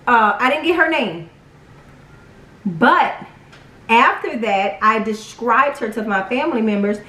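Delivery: normal talking voice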